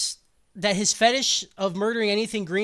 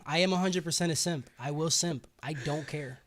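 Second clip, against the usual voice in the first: normal talking voice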